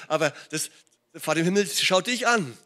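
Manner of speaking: normal talking voice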